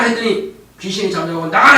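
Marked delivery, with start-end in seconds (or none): 1.5-1.8 loud commanding rebuke; forceful command. Retold or imitated. Jesus ordering the demon out, forceful command. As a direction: order it out, voice raised. 예수님이 귀신을 쫓아내는 명령.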